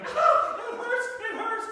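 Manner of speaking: very high, loud pained squeal; squealing yelp. Retold or imitated. Husband squealing in pain when his wife steps on him. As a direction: shriek it like a stepped-on toe.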